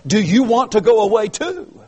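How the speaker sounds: high, loud "Do you want to go away too?" rising on "go"; piercing question. Shown as a demonstration. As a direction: pierce the listeners' hearts with it. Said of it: "A piercing question".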